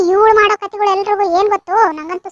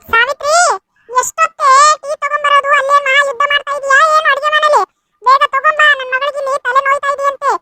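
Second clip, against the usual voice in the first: higher, impatient call across the house; yelling for tea; 5 semitones higher than usual. Acted. Stepmother yelling to Savitri in the kitchen, impatient and higher. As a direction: shout toward the kitchen, demanding.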